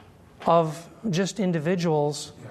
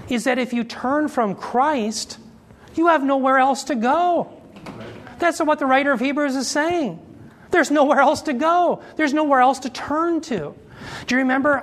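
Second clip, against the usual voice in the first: higher-pitched, emphatic sermon climax; impassioned preaching; 6 semitones higher than usual. Real, not acted. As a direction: drive the warning home to the congregation.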